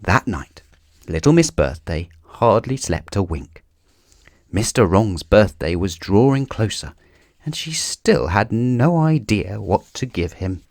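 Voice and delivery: ordinary delivery, nothing marked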